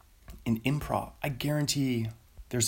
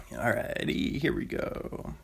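normal talking voice

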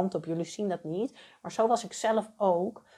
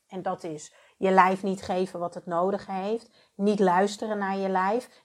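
normal talking voice

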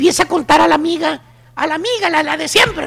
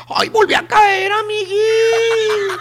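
wailing confession with a long-drawn "amiguis"; hammed-up tearful regret, very slow at the end; mock remorse; 2 semitones higher, much slower than usual. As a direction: fake sobbing, stretch out the last word. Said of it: "con cara de arrepentimiento".

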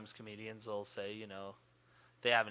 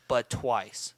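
angry rant; 3 semitones higher, 9 dB louder, slower than usual. Retold or imitated. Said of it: "how fucking mad I was".

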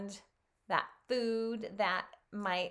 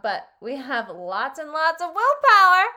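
high, loud triumphant declaration; proud boast; 6 semitones higher, 11 dB louder than usual. A narrator's reading. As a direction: proclaim it with pride.

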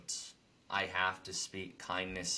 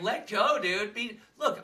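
retold lecture, much higher and louder; preachy advice; 12 semitones higher, 6 dB louder than usual. Retelling what he told the biker, a mock preachy call. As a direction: lecture the biker to let things go, a touch mock. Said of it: "I was telling him".

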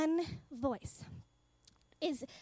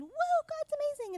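excited awe; 12 semitones higher, 4 dB louder than usual. Retold or imitated. Sudden awe at God.

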